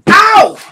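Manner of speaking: sharp high cry of pain; pained yelp. Real, not acted. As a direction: yelp as the sour hits. Sour candy burns mouth.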